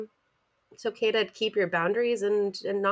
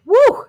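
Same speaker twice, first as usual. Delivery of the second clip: loud high "woo"; rowdy whoop; 14 dB louder than usual. Retold or imitated. Co-host imitating night-out revelry, loud high whoop. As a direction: let out a big party whoop.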